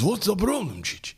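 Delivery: high-pitched cartoon dad on the phone; squeaky puzzled question; hammed-up concerned old man; mate's dad. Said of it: "And he goes".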